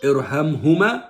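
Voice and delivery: "Irhamhuma" drawn out, a firm Arabic h, rising at the end; slow model recitation. Shown as a demonstration. Mother teaching her son the dua, slow clear recitation. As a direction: spell out "Irhamhuma" slowly for the child to copy. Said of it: "One more time".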